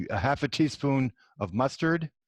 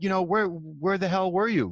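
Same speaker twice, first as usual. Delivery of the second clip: higher-pitched, mildly indignant retelling; mild mock outrage, pitched up; retold fury; 6 semitones higher than usual. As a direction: replay an angry demand without raising the volume. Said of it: "I was furious".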